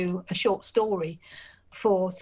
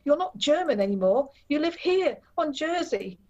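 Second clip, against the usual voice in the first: voice rising in panic; 8 semitones higher than usual. Acted. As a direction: plead with him as panic climbs. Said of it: "My voice rose in panic".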